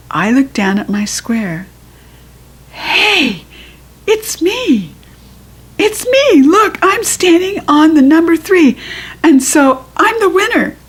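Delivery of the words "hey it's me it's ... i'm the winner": much higher, louder squeal; thrilled squeal. A narrator's reading. Girl squealing about winning the cake, much higher. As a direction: burst with joy at winning. Child realizes she won.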